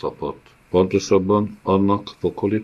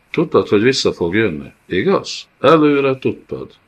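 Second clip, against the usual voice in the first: fast, rising, louder accusation; accusing; 5 semitones higher, 5 dB louder than usual. Acted. Kán confronting Loew about the returning child, accusing. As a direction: corner him with the truth.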